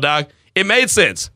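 ordinary delivery

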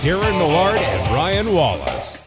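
polished station announcer read; station ID voiceover. Acted. Recorded station ID.

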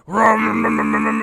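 loud imitated bass rumble; mock boomy speaker noise; booming rumble. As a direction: boom it out like muffled movie bass. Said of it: "I'd hear".

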